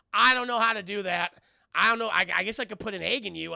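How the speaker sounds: loud, helpless mock protest; baffled exclamation. Goliath asked for children, loud acted bafflement. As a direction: blurt it out, at a loss.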